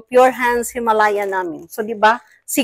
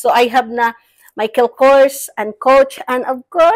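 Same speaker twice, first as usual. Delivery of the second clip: normal talking voice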